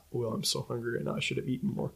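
low-energy, soft retold grumble; hushed, understated admission; quiet complaint. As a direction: say it softly, almost to himself. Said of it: "he was like".

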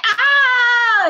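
sudden high yelp of frustration; mock outburst cry; frustrated yelp. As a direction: let out a strangled cry of overwhelm. Reactive outburst at coworker.

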